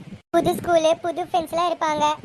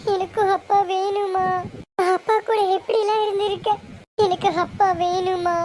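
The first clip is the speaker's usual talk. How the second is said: tearful pleading; 2 semitones higher than usual. Acted. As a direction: plead for an absent father, dragging the words. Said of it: "ஏண்டி அழுகுற".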